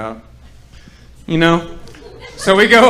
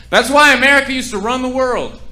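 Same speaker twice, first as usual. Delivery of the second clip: higher, louder proud proclamation of American greatness; mock proud declaration; 9 semitones higher, 5 dB louder than usual. Comic hams up pride in America, loud and high. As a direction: boom it out like a proud patriot.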